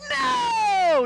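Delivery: loud shouted no. Acted. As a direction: startle the room with one huge no. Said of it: "that probably went really loud over online".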